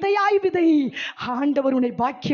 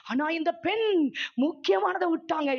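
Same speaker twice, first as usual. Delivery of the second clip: ordinary talk, nothing marked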